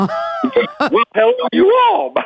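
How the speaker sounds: put-on high-pitched Southern kid's hollered greeting; drill instructor voice. Shown as a demonstration. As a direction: replay the overdone Southern hello. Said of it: "I had a drill instructor voice".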